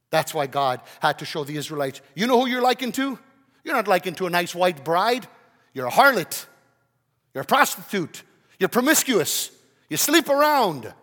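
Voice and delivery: loud, mounting accusation; full-force preaching. Acted. God confronts the Israelites as unfaithful, thundering preacher's delivery. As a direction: build to a high, loud charge.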